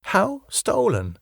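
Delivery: slow, high, wondering question. Acted. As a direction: ask it amazed, drawing it out. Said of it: "I asked in wonder".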